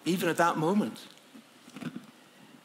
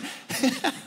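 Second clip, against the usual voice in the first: a quick high chuckle; high laugh; 10 semitones higher than usual. Retold or imitated. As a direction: laugh high between words. John laughing mid-reply.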